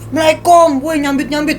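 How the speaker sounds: lightly acted, high-pitched villagers' reply; neighbouring villagers answering. Neighbouring village answers the stone-thrower, lightly acted high call. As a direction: pitch it up as the villagers calling back. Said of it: "yang jawab malah ini kampung sebelah".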